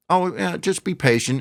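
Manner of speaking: high, airy mockery of a brush-off; mocking quote. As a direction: toss it off like a fake reassurance. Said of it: "the fact that".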